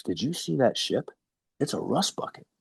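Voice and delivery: lightly voiced upset wife, no sobbing; mildly upset wife. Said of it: "She's literally upset".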